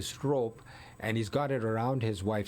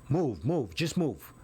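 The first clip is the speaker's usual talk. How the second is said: mildly urgent retold command, pitch lifting; pressured urgency; 3 semitones higher, slower than usual. Killer hurrying the arriving woman along, retold with mild pressure. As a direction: imitate a man under pressure, tersely retold. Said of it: "his tone, his affect, his face is very, um, uh, pressured".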